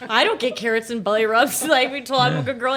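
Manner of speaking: playfully complain, laughing as you go; mock indignant. Jealous of horse's carrots and belly rubs, laughing protest.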